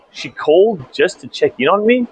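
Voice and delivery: incredulous. Real, not acted. Baffled by a courtesy call.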